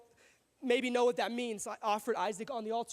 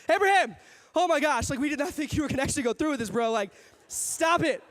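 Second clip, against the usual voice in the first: out of breath; 2 semitones higher, 4 dB louder than usual. Pretend. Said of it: "he was out of breath".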